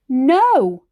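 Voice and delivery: loud high shouted no. A narrator's reading. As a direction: refuse at the top of her voice. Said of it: "shouted Granny".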